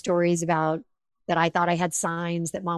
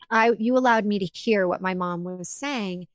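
ordinary talk, nothing marked

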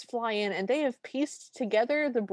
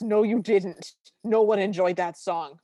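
all caps; 5 dB louder than usual. Retold or imitated. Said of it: "my notes just have all caps".

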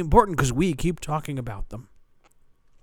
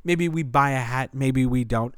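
ordinary talk

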